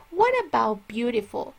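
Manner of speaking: usual speaking voice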